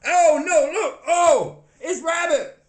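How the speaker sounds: high, loud cry of dismay; alarmed mock announcement. Castmate acting out Rabbit showing up, high mock alarm. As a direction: blurt it out in a high-pitched mock panic. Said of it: "Rabbit would be like".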